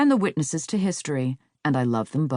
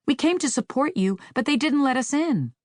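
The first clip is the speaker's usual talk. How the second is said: higher, lightly voiced hurt fan; a hint of a disappointed fan, pitched higher; quoted fan message; 10 semitones higher than usual. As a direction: read their message up high, a touch wounded. Fans' hurt message read aloud.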